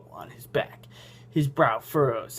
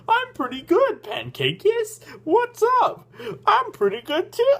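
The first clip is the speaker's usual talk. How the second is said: very high, squeaky reply; silly character voice; 3 dB louder than usual. Shown as a demonstration. Reader answering the character in a silly high voice. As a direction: pitch it way up, playing both sides. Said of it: "I'm turning into Sam".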